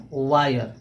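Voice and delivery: वायर with an "eye-uh" sound; आय sound in the middle of वायर; "aay" sound demo. Shown as a demonstration. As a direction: say वायर as "WHY-er". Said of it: "इसको हम लोग pronunciation करते हैं".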